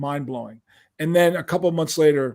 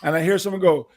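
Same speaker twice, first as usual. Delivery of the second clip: ordinary talk, nothing marked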